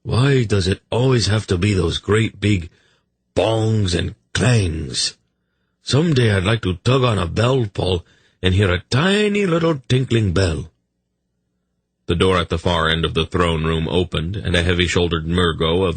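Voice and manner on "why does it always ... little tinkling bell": higher voice, lingering on the noisy words; mock-weary royal complaint, pitched up; complaining king. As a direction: grumble like a whimsical monarch.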